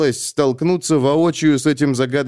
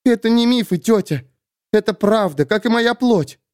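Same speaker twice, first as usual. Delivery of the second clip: put-on higher, urgent young heroine; young woman, urgent; 9 semitones higher than usual. A narrator's reading. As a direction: press it, tense and scared. Sulin voiced higher.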